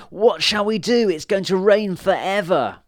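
high, loud and desperate; panicked witch voice. Acted. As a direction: plead in helpless panic. Witches panic about the flood.

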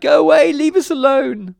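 shrieked high and very loud; full-voiced, high storybook wail; panicked cry. A narrator's reading. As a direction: yell desperately to be left alone. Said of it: "they cried".

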